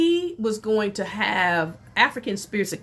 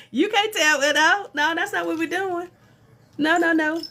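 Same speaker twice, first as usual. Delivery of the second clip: laughing refusal; 7 semitones higher than usual. Retold or imitated. Refusing the reader's rules, laughing and higher.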